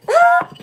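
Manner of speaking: short, high-pitched happy squeal; delighted squeal. Real, not acted. Vlogger thrilled by pink gifts, high delighted squeal.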